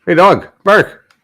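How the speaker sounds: loud, slow call to the dog. Real, not acted. Calling the silent dog.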